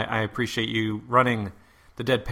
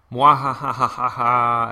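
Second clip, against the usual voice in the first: evil mwahaha cackle; slow evil villain laugh; mad scientist; 2 semitones higher, 8 dB louder, slower than usual. Pretend.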